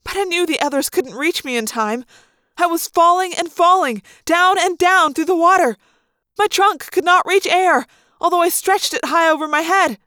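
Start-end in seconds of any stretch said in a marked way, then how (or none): 2.6-10.0 mounting dread. A narrator's reading. Narrator drowning, rising panic.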